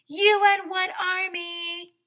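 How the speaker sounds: high, loud, slow defiant yell; loud taunt. Retold or imitated. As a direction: throw the dare right at him. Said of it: "I said".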